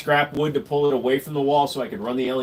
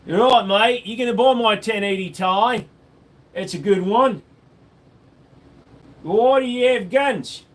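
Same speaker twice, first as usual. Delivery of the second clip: a hammed-up Australian voice, higher and louder; light Aussie accent selling a card; mock Aussie accent; 5 semitones higher, 4 dB louder than usual. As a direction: play a cheery Aussie bloke. Aussie voice before naming it.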